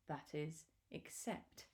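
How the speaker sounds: hushed storyteller whisper. A narrator's reading. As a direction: breathe it out like a secret. Suspenseful pause before Elmer's name.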